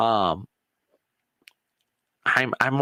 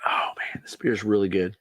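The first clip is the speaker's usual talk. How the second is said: whisper; 4 semitones lower than usual. Real, not acted. Sipping beer mid-vlog, a real whispered aside. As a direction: murmur it just to yourself.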